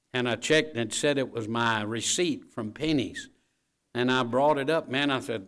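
normal talking voice

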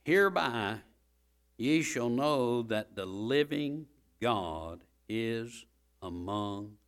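very slow, weighty scripture; slow emphatic reading. Shown as a demonstration. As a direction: linger on every word. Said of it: "think about that statement".

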